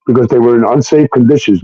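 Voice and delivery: ordinary delivery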